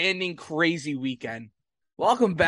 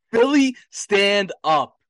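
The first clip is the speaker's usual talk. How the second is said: rallying shout; 3 semitones higher, 7 dB louder, slower than usual. Real, not acted. Rallying the city.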